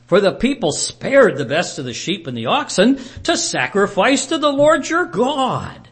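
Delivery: high, loud and eager to justify; defensive Saul. Acted. Saul defending himself to Samuel.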